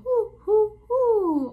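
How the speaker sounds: hooting high like an owl. Shown as a demonstration. Quizzing children on owl sounds, playful hoots. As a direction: hoot it up for the children. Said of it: "What sound do you think they make?".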